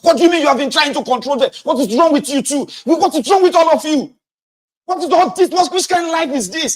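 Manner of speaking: high, loud, fast ranting yell; shouting at wife and kids, fed up; furious shout. Retold or imitated. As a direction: rage at the whole household. Yelling at wife over kids.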